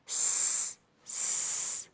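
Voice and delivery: س as a long voiceless hiss; sound of S. Shown as a demonstration. Teacher showing how S sounds, held hiss. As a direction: sustain "sss" for س, no vowel. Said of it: "صوته كده".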